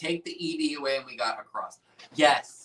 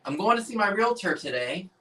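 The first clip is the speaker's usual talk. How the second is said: higher-pitched sample sentence, lightly put on; acted client; 7 semitones higher than usual. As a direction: chirp it as someone with an appointment. Acted example sentence with the word.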